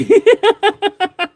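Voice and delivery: embarrassed high laugh; high burst of laughter. Real, not acted. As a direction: crack up in disbelief. Realises he insulted a citizen.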